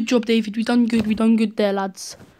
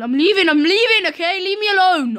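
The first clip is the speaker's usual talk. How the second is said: higher and louder, fed up; exasperated outburst; 8 semitones higher, 6 dB louder than usual. Real, not acted. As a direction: shout that you're leaving them. Exasperated shouting at enemies.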